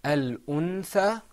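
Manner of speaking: "Al-untha", loud and drawn out; practice model. Shown as a demonstration. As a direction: model "Al-untha" slowly for repetition. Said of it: "So let's practice".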